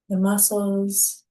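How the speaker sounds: usual speaking voice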